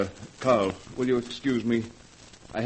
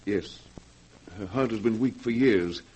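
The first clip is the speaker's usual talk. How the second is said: ordinary talk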